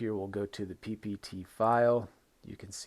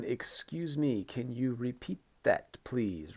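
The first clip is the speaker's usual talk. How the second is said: slow, careful polite request, higher pitch; 3 semitones higher, slower than usual. Shown as a demonstration. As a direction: model a polite question to the teacher. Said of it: "Very important one".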